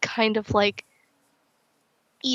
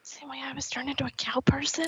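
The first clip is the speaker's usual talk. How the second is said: whisper; 3 semitones higher, 8 dB quieter than usual. Pretend. Whispered aside reply.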